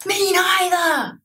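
startled, delighted agreement; surprised gasp. Real, not acted. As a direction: gasp then high shocked "me neither".

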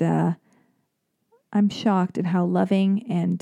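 ordinary talk

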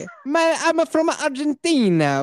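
high, hammed-up Argentinian accent; fake Argentinian accent. Joking with podcast friends, hammed-up Argentinian accent. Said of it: "doing my sweet Argentinian accent".

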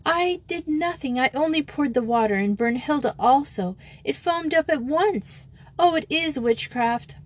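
put-on high, upset girl's voice; acted tearful protest. A narrator's reading. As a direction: protest innocence as if sobbing. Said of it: "sobbed Bet in German".

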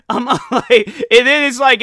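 real laughter, high and loud; laughing. Real, not acted. Telling the viewers the story, laughing out loud. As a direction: laugh through the words.

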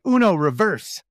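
loud gloating; gloating shout. Retold or imitated. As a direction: crow it triumphantly. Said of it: "You're like".

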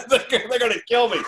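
amused, high-pitched mock alarm; laughing retelling. Real, not acted. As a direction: laugh through the retold panic. Laughing as he retells his panic.

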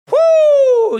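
piercing, drawn-out "woo"; high loud whoop. Real, not acted. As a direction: let out a long high whoop. Host reacts to trigger topic.

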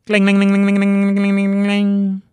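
high, loud chanted cling like a ringing bell; mock alarm bell. Host sounding an alarm for viewers, bell-like cling chant. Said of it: "Emphasis on that".

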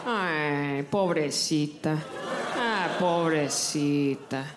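put-on low, slow old abuela pity; pitying great grandma. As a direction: drag out pobrecita with pity. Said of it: "my great grandma's voice".

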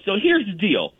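lightly voiced guide setting out the plan; imitating Sally. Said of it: "she goes".

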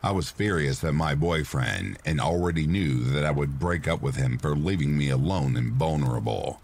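ordinary delivery

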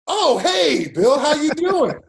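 high, loud, drawn-out delighted greeting; acted-out sudden realization, booming hello; surprised recognition. Player realizing which coach is calling, hammed-up surprise.

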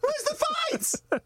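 high, slow, stretched out through a laugh; laughing disbelief. Retold or imitated. Dad retelling the missing fight, laughing incredulously.

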